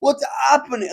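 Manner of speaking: high, alarmed what's happening; hammed-up confusion; mock panic. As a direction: act out panicked guests. Guests freaking out.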